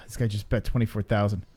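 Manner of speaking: ordinary talk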